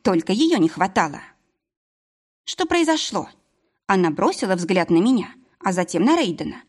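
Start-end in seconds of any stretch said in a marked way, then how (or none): 2.5-3.3 встревоженно. Acted. General's daughter runs in alarmed.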